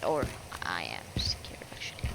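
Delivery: hushed whispered confession; whispering. Real, not acted. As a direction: whisper it, a bit shaken. Admits being scared.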